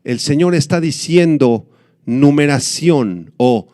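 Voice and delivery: normal talking voice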